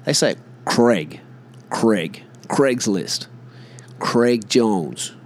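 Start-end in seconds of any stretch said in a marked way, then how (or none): 0.6-2.2 "Craig" with short e, "Creg"; American pronunciation. Shown as a demonstration. Showing how Americans say the name, American pronunciation. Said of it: "when the Americans say it". 2.5-5.1 "Craig" as short "Creg" in both names; American pronunciation. Shown as a demonstration. Giving American examples of the name, American pronunciation. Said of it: "when the Americans say it".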